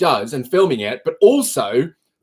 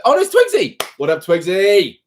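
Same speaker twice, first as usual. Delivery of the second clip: ordinary delivery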